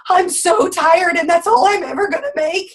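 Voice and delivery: retold meltdown, pitched high; freaking out. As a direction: panic over the paycheck. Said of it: "I freaked out".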